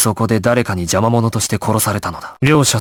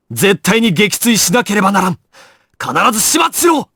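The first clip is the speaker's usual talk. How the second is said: urgent order; 8 semitones higher than usual. Acted. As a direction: demand it be finished. Ordering the helicopter shot down. (緊迫した命令)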